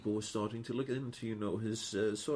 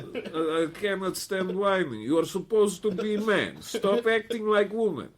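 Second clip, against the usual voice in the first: much higher, louder mock Slavic nurse; Slavic accent; 10 semitones higher, 9 dB louder than usual. Mocking the Slavic dental nurse scolding a whiny patient, loud Slavic accent. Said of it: "really horrible accent".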